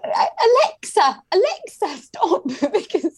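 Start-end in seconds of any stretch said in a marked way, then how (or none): 0.7-2.4 put-on posh English accent. Mum commanding the smart speaker, in a posh accent. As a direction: say it in your best posh voice. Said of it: "oh, you do the posh voice?".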